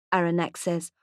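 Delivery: ordinary delivery, nothing marked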